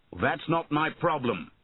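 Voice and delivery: mildly angry storybook shout. A narrator's reading. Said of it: "shouted Bowen".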